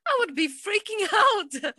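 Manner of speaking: high, giggling through the words; real laughter breaking up the line; laughing. Real, not acted. Laughing to her friend about freaking out, high and giggly.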